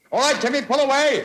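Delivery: high, loud call up. Acted. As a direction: shout to the boy to pull. Calling up to Timmy.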